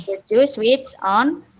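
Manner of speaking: same slow dictation of Could you switch on; slow model sentence. Shown as a demonstration. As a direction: keep the words spaced and clear. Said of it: "teacher repeats model slowly".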